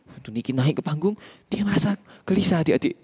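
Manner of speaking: usual speaking voice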